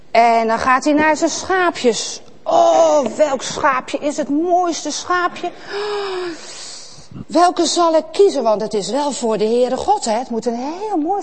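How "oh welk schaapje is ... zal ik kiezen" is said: higher, slow wondering voice; pondering Abel. Acted. Abel lovingly choosing the finest lamb. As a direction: savour the choice like a child.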